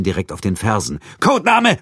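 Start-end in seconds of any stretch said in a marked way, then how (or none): 1.2-1.8 bellowed order, much higher and louder; barked drill commands. A narrator's reading. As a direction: bark it like a drill sergeant. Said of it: "brüllte der Feldwebel". (gebrüllter Befehl)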